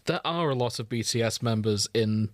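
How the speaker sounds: normal talking voice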